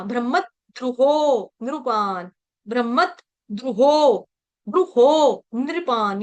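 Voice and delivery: ब्रह्मद्रुहो with a held, doubled m before द्र; stressed conjunct. Shown as a demonstration. Teacher showing where the stress falls, ब्रह्मद्रुहो slow with doubled m. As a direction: lean on ब्रह्म, then draw out द्रुहो slowly. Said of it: "यहाँ पर मैंने आघात लिया ब्रह्म".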